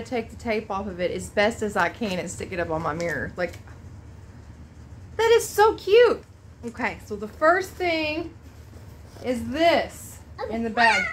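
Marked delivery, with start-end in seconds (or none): 5.2-6.2 high, loud gush of delight. Real, not acted. Mother admiring a friend's drawing, gushing. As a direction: coo over how cute it is.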